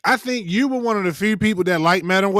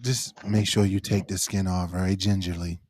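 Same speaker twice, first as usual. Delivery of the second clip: low, soft ASMR murmur; mock ASMR; 10 semitones lower, 7 dB quieter than usual. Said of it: "A S M R with cucumber".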